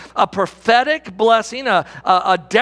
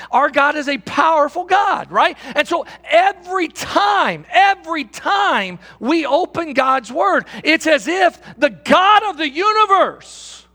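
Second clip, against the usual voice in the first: preaching; 6 semitones higher than usual. Acted. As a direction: preach it out slowly with power. Preaching at full force.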